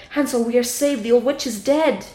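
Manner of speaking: loud, mildly excited call; joyful cry. Acted. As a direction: cry out the good news. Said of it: "She opened the door and cried".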